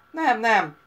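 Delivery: loud flat refusal; firm refusal. A narrator's reading. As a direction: shake it off loudly. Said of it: "ingatta fejét".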